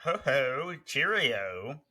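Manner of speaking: slow, pompous fake English accent; lightly put-on English gent; hammy English accent. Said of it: "I'm like".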